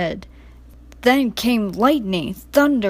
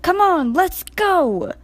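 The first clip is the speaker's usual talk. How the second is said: eager; 8 semitones higher, 3 dB louder, slower than usual. A narrator's reading. Percy rallies, steam up.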